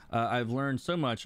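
ordinary talk, nothing marked

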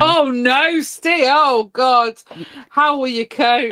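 high-pitched mock horror; mock dismay. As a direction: wail in teasing, exaggerated sympathy. Mock sympathy over co-host's small chore.

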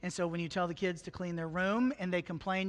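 usual speaking voice

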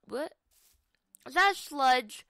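normal talking voice

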